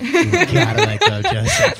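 a hammed-up laughing call to Mom; high, loud, laughing plea. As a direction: belt it to Mom through laughter. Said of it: "Mom, Mom, if you're listening".

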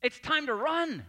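an excited nudge to get up and go; God urging you on. Retold or imitated. God telling a lazy listener to get moving, excited. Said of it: "He might also say to you".

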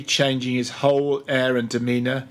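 usual speaking voice

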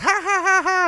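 very high taunting ha-ha; mock taunting laugh. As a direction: cackle like a prankster. Mocking prankster laugh.

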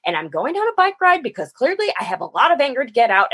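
high, rushed, fed-up rant; retold frustrated shouting. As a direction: pour out the anger to dad without pausing. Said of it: "I'm sorry to be shouting at you".

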